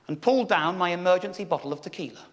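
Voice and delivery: ordinary talk, nothing marked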